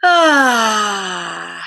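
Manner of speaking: long, loud falling "ah"; drawn-out open sigh. Real, not acted. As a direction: let out a big vocal sigh, sliding downward. Loud drawn-out sigh between greetings.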